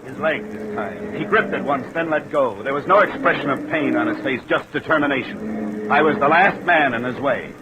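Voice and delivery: breathless, urgent retelling of the struggle; tense narration. A narrator's reading. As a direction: tell it with rising dread.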